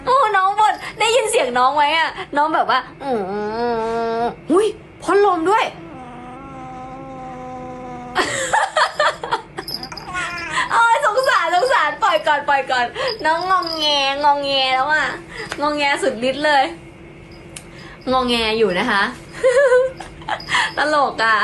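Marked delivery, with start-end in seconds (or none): 0.0-5.7 put-on baby talk to pet. Talking to grumbling cat. 5.7-10.7 a long laughing squeal. Real, not acted. Cat hissing, she laughs. 10.7-16.6 put-on baby talk to pet. Pitying the fussing cat. 19.1-20.7 a high squeaky laugh; high giggle. Real, not acted. Laughing at cat.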